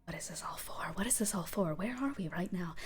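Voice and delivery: hushed, half-whispered questioning; confused thoughts spoken under the breath; bewildered figure. Acted. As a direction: murmur it, lost and searching. Morphing figure's murmured thoughts.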